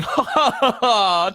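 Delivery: loud, high poltergeist laughter; shrieking high loud cackle; gleeful high cackle. Acted. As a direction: cackle in triumph at the trick. Peeves cackling.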